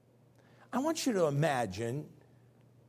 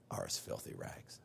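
whispered, much lower and softer; dramatic whisper; 14 dB quieter than usual. Acted. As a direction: drop the voice to a breath on the key phrase. Verse climax, dropped to whisper.

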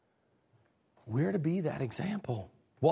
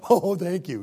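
lightly voiced grateful dog, louder; happy dog's reply; 2 semitones higher, 6 dB louder than usual. A dog fooled by sweet tone replies, pleased. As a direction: answer like a delighted pup. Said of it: "And they're like".

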